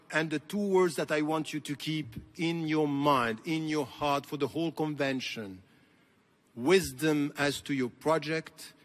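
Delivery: ordinary talk, nothing marked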